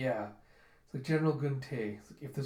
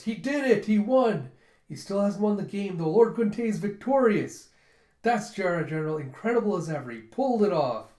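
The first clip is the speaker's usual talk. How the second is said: much higher and louder, cheering the win; triumphant cheering; 6 semitones higher, 7 dB louder than usual. Retold or imitated. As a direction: shout for joy at the victory. Trudella cheers the victory.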